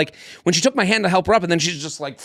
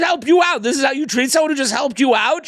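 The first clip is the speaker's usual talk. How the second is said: higher, indignant scolding; retold yelling; 10 semitones higher than usual. Man scolding the woman he helped, mock yelling. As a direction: act out an outraged telling-off. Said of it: "when you're yelling at her".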